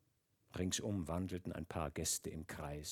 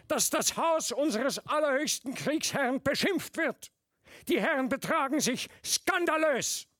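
raised voice; 11 dB louder than usual. A narrator's reading. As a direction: defy the officers loudly. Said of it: "Er hob die Stimme".

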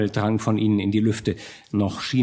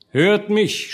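high, raised call for attention; commanding call; 8 semitones higher, 3 dB louder than usual. A narrator's reading. Strong man Hartmann addresses the burghers, commanding call. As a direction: call the crowd to listen. Leader calls crowd to listen.

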